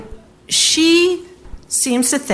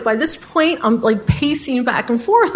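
ordinary talk, nothing marked

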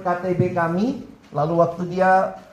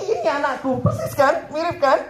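high-pitched playful boasting parent; acted pride, pitched up; proud heavenly Father; 7 semitones higher than usual. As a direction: play God as a delighted dad showing off his child. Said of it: "kira-kira Tuhan ngomong apa ya".

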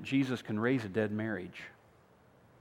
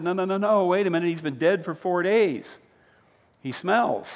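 higher, louder flustered objection; alarmed protest; 5 semitones higher, 5 dB louder than usual. Acted. Martha objecting to opening Lazarus' tomb, alarmed. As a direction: stop him in a hurry, alarmed.

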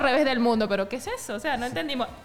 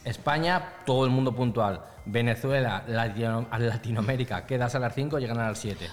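mock deep voice. Wife lecturing about national punctuality, in a mock deep voice. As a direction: drop the voice way down, mock serious.

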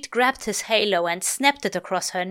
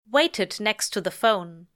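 ordinary delivery

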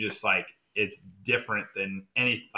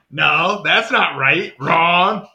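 higher, loud emphatic no, not right, wrong; mock correction; 6 semitones higher, 10 dB louder, much slower than usual. Correcting teammate's English, loud mock scolding. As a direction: correct him with comic force.

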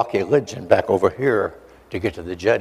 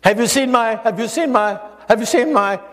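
much higher, strained voice searching for someone; frantic bystander; 11 semitones higher than usual. Retold or imitated. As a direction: cry out the question over and over. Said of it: "People are screaming and milling around".

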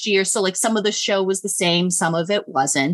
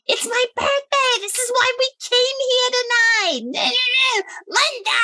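high-pitched mock heckling; hammed-up, drawn-out shrieking; imitated screaming; slower than usual. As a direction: screech like an obnoxious audience member. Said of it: "women start screaming out".

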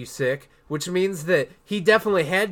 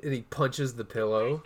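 usual speaking voice

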